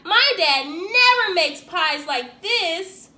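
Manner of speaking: loud, slow admiring line; impressed kid. A narrator's reading. Friend praising the pie to the boy's dad, loud and slow. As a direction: savour the compliment. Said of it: "Jeremy said".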